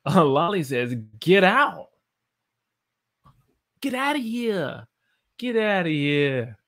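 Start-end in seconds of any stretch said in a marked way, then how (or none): 1.2-6.6 high, slow, drawn-out shoo; dramatic get out. Shown as a demonstration. Teacher dramatizing a chat suggestion, mock huff. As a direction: push them away in a mock huff.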